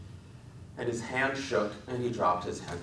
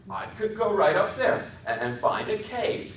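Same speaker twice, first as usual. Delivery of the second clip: put-on a big child imagining a hideout; Lenny's voice; 13 semitones higher, 4 dB louder than usual. A narrator's reading.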